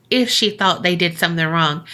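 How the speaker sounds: ordinary delivery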